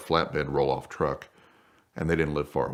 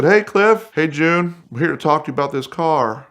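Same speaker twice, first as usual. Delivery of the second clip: high, loud hail that eases off; calling out a greeting; 10 semitones higher, 7 dB louder than usual. Retold or imitated. Greeting Cliff and June as the van stops, loud and high. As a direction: shout up the driveway cheerfully.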